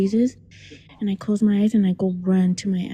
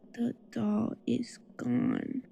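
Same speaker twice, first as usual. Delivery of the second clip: usual speaking voice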